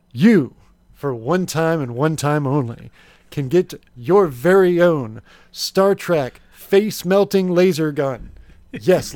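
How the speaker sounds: hammed-up infomercial salesman; home shopping host. Infomercial pitch of gadgets.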